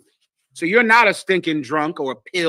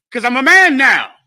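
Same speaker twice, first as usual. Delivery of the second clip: louder, higher mock bravado; boastful declaration; 5 semitones higher, 7 dB louder than usual. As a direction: puff up and proclaim it. Repeats youth's boast of manhood.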